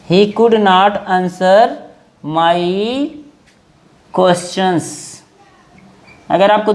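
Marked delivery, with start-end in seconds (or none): 0.1-5.2 very slow, word by word; slow dictation. Shown as a demonstration. Teacher dictating the sentence to students, very slowly. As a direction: spell it out slowly so students can write. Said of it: "he could not answer (slow repeat)".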